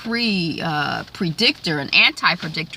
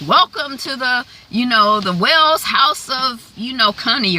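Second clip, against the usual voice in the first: put-on high, louder cute-kid greeting; cute little boy; 5 semitones higher, 4 dB louder than usual. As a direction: play a sweet little boy welcoming visitors. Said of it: "He's being a cute little boy".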